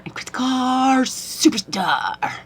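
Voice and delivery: high, slow announcer hype; hyped up. Acted. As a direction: announce yourself like a star. Hyping herself up.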